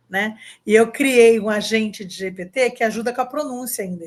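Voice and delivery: ordinary delivery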